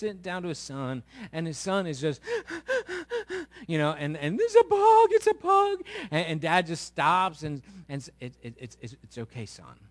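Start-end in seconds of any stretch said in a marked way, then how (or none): 2.3-3.6 put-on high sharp child's gasp; high gasp of fright; frightened gasp. Son frightened by bug. 4.4-5.8 put-on high, loud frightened child's cry; high, loud child's panic; panicked child. Four-year-old screaming at bug. 9.0-9.8 soft low soothing; softer, lower fatherly reassurance; reassuring dad. Retold or imitated. Dad calming scared son.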